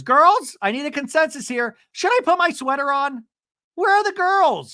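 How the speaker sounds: put-on high, loud call to the girls; mock calling out. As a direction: imitate an excited woman summoning friends. Imitates Alexis calling out to friends.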